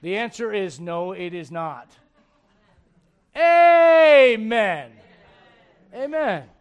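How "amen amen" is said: very loud, high drawn-out Amen; preacher's ringing Amen; loud call of amen. Real, not acted. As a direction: call the room to answer you. Preacher calling for response.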